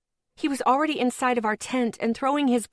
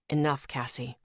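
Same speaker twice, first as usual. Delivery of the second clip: lower, softer male voice; lightly voiced boyfriend; 8 semitones lower, 5 dB quieter than usual. A narrator's reading. Boyfriend shuts Cassie down, lower and soft. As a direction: settle it, low and firm.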